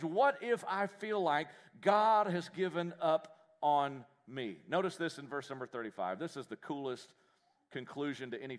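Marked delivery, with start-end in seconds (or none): 0.0-4.6 slow emphatic question. Shown as a demonstration. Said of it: "slowed restatement of the question".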